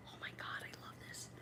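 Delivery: unvoiced, hushed whisper of delight. Real, not acted. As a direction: breathe it out in secret awe.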